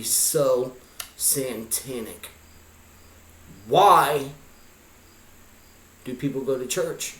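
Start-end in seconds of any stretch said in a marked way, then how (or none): none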